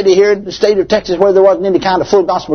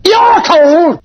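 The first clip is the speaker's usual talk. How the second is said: loud, much higher shocked cry; booming outcry; 13 semitones higher, 4 dB louder than usual. Retold or imitated. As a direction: shout it back at him in disbelief. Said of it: "she just had a loud booming voice".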